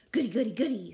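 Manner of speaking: loud, gleeful little chant; excited child. Acted. Stella finally allowed to play, loud delighted excitement. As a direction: burst out with glee.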